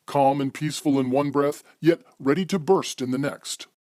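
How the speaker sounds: ordinary delivery, nothing marked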